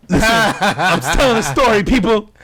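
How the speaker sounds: laughing protest. Real, not acted. Storyteller laughingly defending a slur he repeated. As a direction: plead through laughter.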